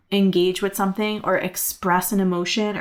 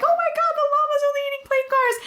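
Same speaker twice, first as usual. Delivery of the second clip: very high, fast llama squeak; much faster than usual. Acted. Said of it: "That's my llama voice".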